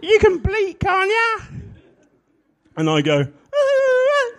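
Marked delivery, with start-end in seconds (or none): 0.0-1.5 much higher, girlish impression of a colleague; little-girl voice. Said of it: "And she says". 3.5-4.3 goat bleat. Retold or imitated.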